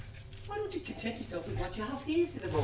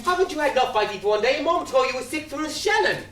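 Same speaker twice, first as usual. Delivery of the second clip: loud, raised-pitch rebuke; exasperated scolding; 7 semitones higher, 8 dB louder than usual. Acted. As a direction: scold your mate loudly. Quarrelling over the fighting.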